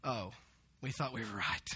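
low, quiet letdown; acting out crestfallen disappointment; deflated. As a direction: let all the excitement drop out. Scribes deflated after being rebuked.

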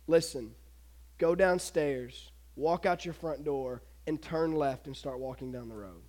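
understated, deliberate order from an angel; calm angel command. Acted. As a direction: give each instruction quietly, with pauses between. Angel's solemn instructions, retold.

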